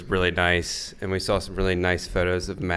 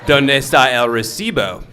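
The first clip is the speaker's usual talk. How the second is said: higher, louder mock Spanish; eighth-grade Spanish accent; 5 semitones higher, 6 dB louder than usual. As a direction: put on a schoolroom Spanish accent. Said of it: "using our eighth grade Spanish".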